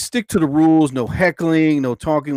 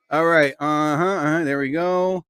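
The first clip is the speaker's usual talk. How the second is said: usual speaking voice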